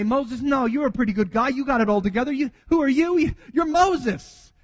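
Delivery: high, hyped-up flattery, climbing in pitch; acted gushing reassurance; mock pep talk. Imagined flattering pep talk to Moses.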